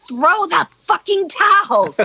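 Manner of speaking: retold high, drawn-out order; imitated exasperated shout, edged with laughter; exasperated yell. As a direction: yell it at a dawdling helper. Yelling orders up at dawdling helper.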